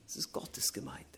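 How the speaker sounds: hushed whisper. Acted. Preacher whispering to the church whose church it is. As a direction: let it drop to a whisper. Soft aside stressing God's church.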